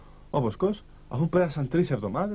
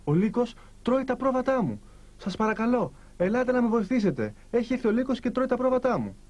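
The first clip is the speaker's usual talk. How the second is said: higher-pitched mock call for help, little extra volume; storybook cry for help; 7 semitones higher than usual. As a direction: plead like a shepherd calling villagers, lightly acted. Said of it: "άρχισε λοιπόν να τους φωνάζει".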